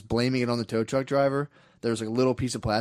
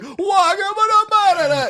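very high, loud gibberish outburst; hammed-up shouted imitation of angry men; imitated going off; 9 dB louder, slower than usual. Men going off on the kid, imitated outburst. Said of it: "they start going off on him".

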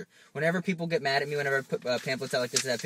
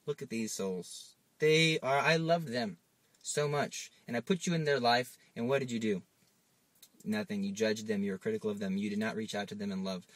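sorrowful Lord; 4 semitones lower, 4 dB quieter than usual. Pretend. The Lord softly rebuking a soul at judgment. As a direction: grieve over the lost souls. Lord grieving over souls.

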